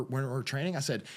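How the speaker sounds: normal talking voice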